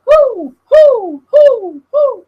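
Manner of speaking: very high loud crowd whoo. Retold or imitated. The crowd reacting to the rap line, with loud high whoops. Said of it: "everyone's like".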